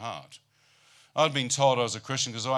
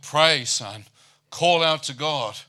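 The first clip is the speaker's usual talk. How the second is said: loud, urgent mother's call; loud, pleading mother urging prayer; urgent plea; 3 semitones higher, 8 dB louder, much slower than usual. Retold or imitated. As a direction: urge him hard to pray out loud. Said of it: "mother urgently urging him to pray".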